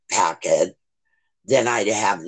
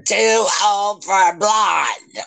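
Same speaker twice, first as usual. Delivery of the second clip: slow playful shout; slow teasing yell, pitched high; 8 semitones higher, 4 dB louder, slower than usual. Real, not acted.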